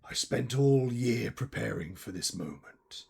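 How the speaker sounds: theatrical villain monologue with deliberate pauses; bitter villainous curmudgeon. Acted. Curmudgeon explains the plot against Christmas, menacing monologue. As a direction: spit out the hatred, then recount it ominously. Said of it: "I am the Christmas curmudgeon".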